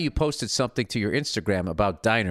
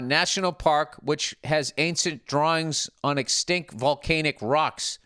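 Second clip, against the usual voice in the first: ordinary delivery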